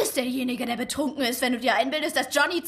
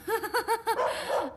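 put-on drunken laugh; 8 semitones higher, 4 dB quieter than usual. Drunk woman laughs at Elmina.

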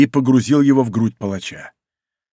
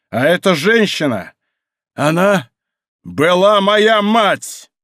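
high, forceful, building to a drawn-out climax; гневное откровение; 6 semitones higher, 3 dB louder, slower than usual. A narrator's reading. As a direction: hurl the revelation, stretching the final words. Avenger's climactic revelation. (с нарастающим гневом)

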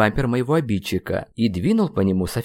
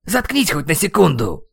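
raised-pitch exasperated outburst; losing patience; 7 semitones higher, 3 dB louder than usual. A narrator's reading. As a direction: snap in exasperation. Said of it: "не выдержал я". (раздражённый выкрик)

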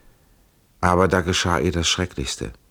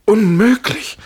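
very high, loud exclamation; astonished cry; 8 dB louder, slower than usual. A narrator's reading. Prisoner learns she lives close by, astonished cry. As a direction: cry out in disbelief. Prisoner stunned by her news.

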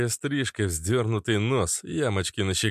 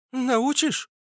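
very high, eager question; admiring girl. Acted. Redhead admires the transformation, high and eager. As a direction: ask in a small high voice. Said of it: "восхитилась".

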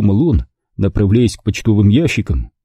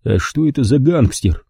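plain reading voice

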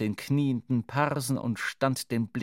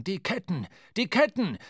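high, loud cry of alarm; startled call; 7 semitones higher, 3 dB louder than usual. A narrator's reading. Starbuck to the crew in the lightning, startled shout. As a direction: call out urgently, louder the second time. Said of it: "rief Starbuck der Mannschaft zu, aufgeschreckt".

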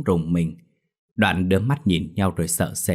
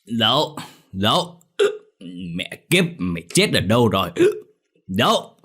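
put-on drunken slurring call; 3 semitones higher than usual. A narrator's reading. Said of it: "Cái giọng lẻ nhẹ gọi vang".

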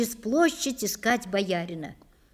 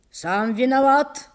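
raised, loud accusation; louder, higher scolding cry; shouted rebuke; 4 semitones higher, 5 dB louder than usual. A narrator's reading. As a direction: shout the blame at him. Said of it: "крикнул Василий".

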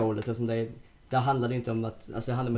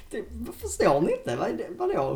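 baffled disbelief; 10 semitones higher than usual. Retold or imitated. Baffled at hostess.

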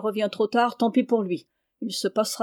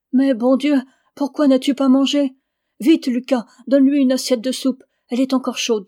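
louder, higher urgent worry; alarmed mother; 4 semitones higher, 7 dB louder than usual. A narrator's reading. Mother frightened for her son, louder and higher urgent call. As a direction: cry out in alarm, then hurry him.

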